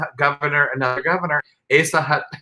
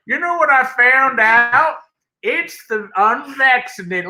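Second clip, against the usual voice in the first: high, slow Southern imitation; mock Southern accent; 10 semitones higher, 4 dB louder, slower than usual. Co-host mimicking the Alabama governor, mock Southern accent. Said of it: "But I heard her go".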